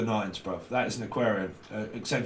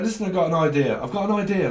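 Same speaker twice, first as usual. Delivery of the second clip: higher, louder eager pitch; a lightly voiced keen friend with an idea; eager announcement; 6 semitones higher, 4 dB louder, faster than usual.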